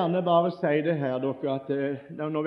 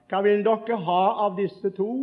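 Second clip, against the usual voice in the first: mocking call; 6 semitones higher, 5 dB louder than usual. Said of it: "så roper han ut til alle elevene".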